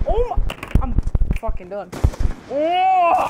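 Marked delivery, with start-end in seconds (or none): 2.5-3.3 loud high cry; startled yell. Real, not acted. Gamer shouting at a sudden kill, loud yell. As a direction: yell it out in real shock. Screams at game event.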